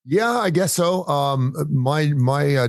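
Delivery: usual speaking voice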